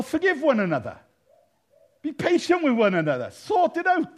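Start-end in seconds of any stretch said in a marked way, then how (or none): 3.3-4.2 much higher, louder order; sharp command. Real, not acted. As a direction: snap it at the congregation.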